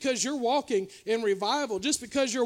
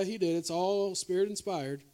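usual speaking voice

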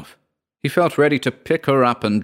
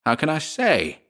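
mildly savage retort, pitched up; understated exasperation, higher; savagely; 7 semitones higher than usual. A narrator's reading. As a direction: snap back with restrained anger. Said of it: "he answered savagely".

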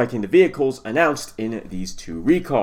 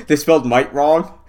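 breaking into a laugh while talking; amused laughter; 2 semitones higher, 3 dB louder than usual. Real, not acted. Host spotting a typo in the article, laughing. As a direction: laugh as you point out the mistake.

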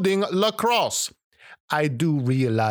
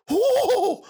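sudden high startled oh; shocked at his own voice; startled gasp. Acted. First hearing his new voice.

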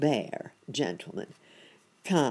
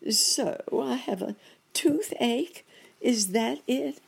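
high, loud dismayed rabbit voice; 10 semitones higher, 3 dB louder than usual. A narrator's reading. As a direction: ask in comic dismay. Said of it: "asked Uncle Wiggily".

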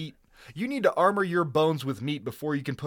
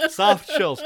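echoed quote; 5 semitones higher, 5 dB louder than usual. Acted. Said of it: "echoing the quoted line".

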